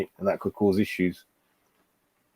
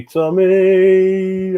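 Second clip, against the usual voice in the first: calling out; 8 semitones higher, 5 dB louder than usual. Real, not acted. Calling out to Tommy.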